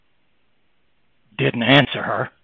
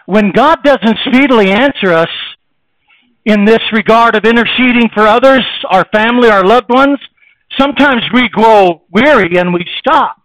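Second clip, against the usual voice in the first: loud, high, ringing sermon delivery; full-force preaching; 7 semitones higher, 9 dB louder than usual. Acted. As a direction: preach it out to the whole congregation.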